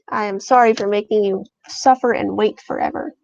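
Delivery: ordinary delivery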